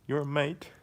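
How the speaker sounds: loud, high Australian caricature; Aussie accent. Shown as a demonstration. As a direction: throw out an Aussie line with a rising end. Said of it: "all you have to do for Aussie".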